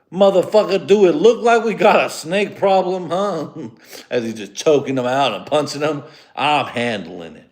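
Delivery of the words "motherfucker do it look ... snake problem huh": much higher and louder, indignant shouted challenge; hammed-up furious action hero; Samuel L. Jackson impression. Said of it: "Samuel L. Jackson was like".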